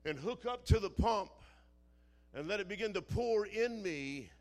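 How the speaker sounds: loud, driving pulpit delivery; loud preacher, stretching the final phrase; forceful preaching. Real, not acted. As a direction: drive the point home to the congregation.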